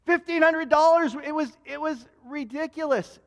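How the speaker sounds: exclaimed high and loud; almost can't believe the sum; overwhelmed. Real, not acted. Preacher telling congregation of the cheque, amazed. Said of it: "I actually felt overwhelmed".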